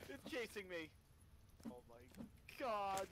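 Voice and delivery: frantic scared cry; scared horror game streamer. Real, not acted. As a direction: shout it out while running in fear. Fleeing a creature in game.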